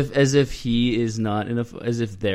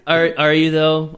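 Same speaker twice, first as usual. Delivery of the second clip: teasing challenge; 4 semitones higher, 6 dB louder, slower than usual. Real, not acted. Teasing doubt of kidding.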